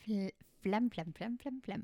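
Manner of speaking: rattly chesty "phlegm" repeated; phlegmy Friends quote. Retold or imitated. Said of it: "Is it from Friends?".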